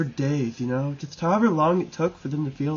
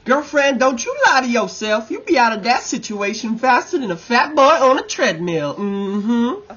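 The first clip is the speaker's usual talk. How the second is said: sassy, higher and louder, calling her out; sassy callout; 8 semitones higher, 7 dB louder than usual. Acted. Host calls out a contestant with sassy mock scolding. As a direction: play the diva setting a friend straight.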